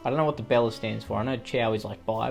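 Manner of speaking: ordinary delivery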